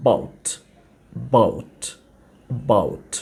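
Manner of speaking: "বাউট" clipped, leading uh barely sounded; correct about. Shown as a demonstration. Teacher models the right way to say about, low and clipped. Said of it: "এটার real উচ্চারণটা হয় হচ্ছে".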